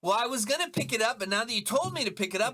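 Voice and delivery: high, rising protest; mock indignant refusal. Worker told to pick up what he dropped, high mock indignation. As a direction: whine back like a stubborn imitated worker.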